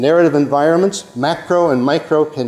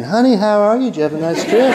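higher-pitched, sweet Georgia Southern greeting; Southern accent; 8 semitones higher than usual. Retold or imitated. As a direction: greet like a friendly Atlanta local. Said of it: "where people are saying".

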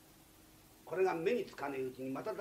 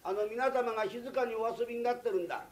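heated retort; 5 semitones higher, 5 dB louder than usual. Acted. Master and guest start quarrelling.

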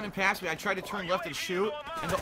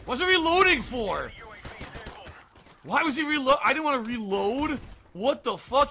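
loud, high, incredulous complaint; real frustration at the game; exasperated protest; 6 semitones higher, 4 dB louder than usual. Real, not acted. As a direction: raise the voice in outraged disbelief. Player protests unwanted reload.

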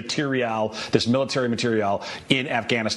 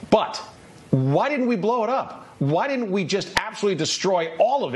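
incredulous; 8 semitones higher than usual. Real, not acted. Host's indignant question.

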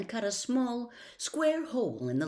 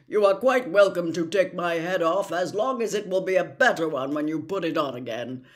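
Scarecrow voice; 8 dB louder than usual. Acted. Scarecrow telling his friends he'll get brains, loud and eager. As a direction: crow it out like a jubilant scarecrow.

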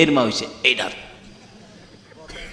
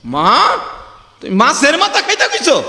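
cry out; 9 semitones higher than usual. Acted. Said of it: "একটা চিৎকার মারছে".

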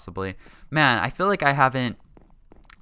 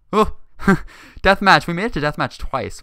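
ordinary talk

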